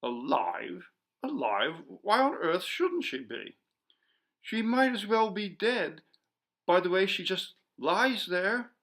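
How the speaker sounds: severe. A narrator's reading. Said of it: "continued to look at her severely".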